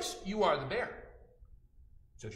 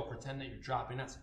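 ordinary delivery

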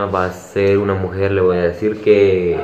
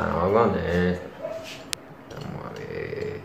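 slower, softer repeat; muttered quietly; 3 semitones lower, 10 dB quieter, slower than usual. Real, not acted. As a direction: mutter it to yourself.

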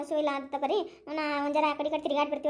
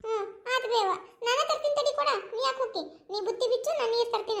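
daughter's voice; 7 semitones higher than usual. Acted. S0 voices daughter fetching water.

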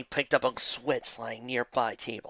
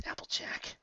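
whisper; 12 dB quieter than usual. Acted. Rainbow answers in despair.

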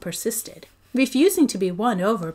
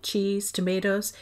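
plain reading voice

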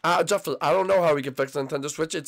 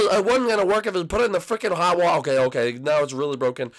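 ordinary talk

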